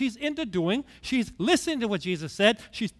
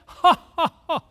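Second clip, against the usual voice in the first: big hearty laugh, much louder and higher; 9 semitones higher, 9 dB louder than usual. Real, not acted. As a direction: laugh out loud at the joke. Laughing at his own joke.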